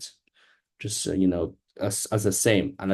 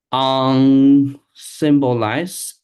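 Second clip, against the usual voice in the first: unsymbolized drawn out very slowly, loud and higher; sounding out; 4 semitones higher, 10 dB louder, much slower than usual. Real, not acted. Learner reading a new term to the tutor, sounding out. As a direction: stretch each part of unsymbolized, loudly.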